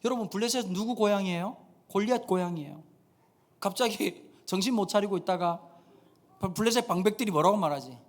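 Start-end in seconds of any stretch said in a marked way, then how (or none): none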